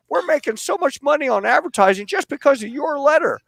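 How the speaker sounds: hammed-up Jimmy Stewart posing as the newspaper editor. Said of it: "I put on my Jimmy Stewart voice".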